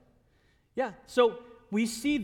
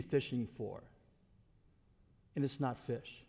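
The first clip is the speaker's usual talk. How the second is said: low, soft, patient reassurance; Jesus; 6 semitones lower, 4 dB quieter than usual. Acted. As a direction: speak gently and quietly, leaving long pauses. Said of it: "it's almost like Jesus is saying".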